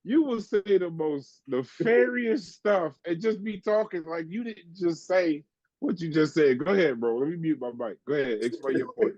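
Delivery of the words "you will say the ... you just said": laughing while talking. Real, not acted. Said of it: "I was trying not to laugh".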